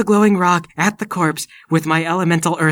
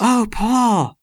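high, loud shocked greeting; startled exclamation; surprised cry; 9 semitones higher, 6 dB louder than usual. Acted. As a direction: caught off guard by the living. Seeing Paul alive.

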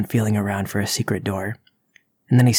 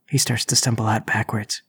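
ordinary talk, nothing marked

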